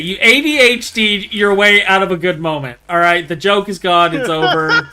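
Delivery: loud teasing scold; mock scolding. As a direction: rub it in playfully.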